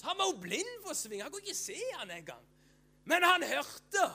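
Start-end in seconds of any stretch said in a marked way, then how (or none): 3.1-4.0 high, loud burst; emphatic proclamation. Real, not acted. Preacher telling congregation that Bartimeus heard, loud proclamation. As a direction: proclaim it at full voice.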